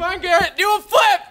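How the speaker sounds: loud, high taunting yell; goading shout. Real, not acted. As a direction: shout him on to jump. Goading friend to jump.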